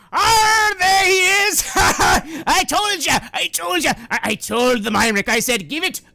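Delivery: high, loud, jubilant outburst; exultant shouting. Acted. Yosef to his rescuers from the cell, exultant shouting. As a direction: crow with excitement, words tumbling and stammering.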